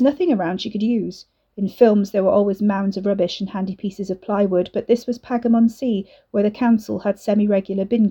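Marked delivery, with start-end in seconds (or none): none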